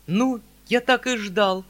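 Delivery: loud, high, slow outburst of dismay; exclaiming. Acted. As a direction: throw up your hands and cry out. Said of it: "вскричал дядя".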